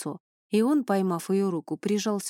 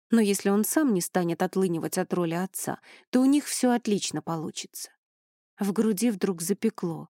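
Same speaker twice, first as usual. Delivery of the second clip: usual speaking voice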